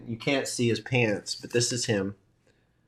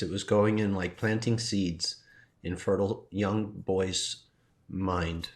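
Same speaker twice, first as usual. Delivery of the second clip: normal talking voice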